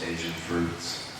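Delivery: ordinary delivery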